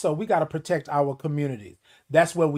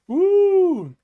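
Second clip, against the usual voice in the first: high loud whoop; high joyful woo; excited whoop; 13 semitones higher, 6 dB louder than usual. Real, not acted. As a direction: let out a big happy whoop. Excited whoop mid-show.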